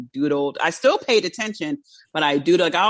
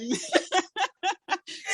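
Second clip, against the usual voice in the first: high breathy giggle; 14 semitones higher, 6 dB quieter than usual. Real, not acted. Host laughing at their own listening noises, high giggle. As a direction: let a high giggle burst out.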